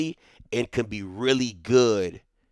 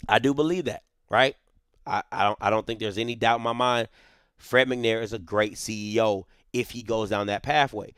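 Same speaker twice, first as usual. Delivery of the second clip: ordinary talk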